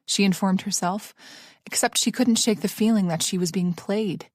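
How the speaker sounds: plain reading voice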